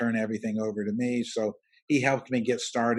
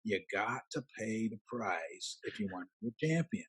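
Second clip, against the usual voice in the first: a lightly put-on Southern coach accent, softer; 7 dB quieter than usual. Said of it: "he had a real Southern accent".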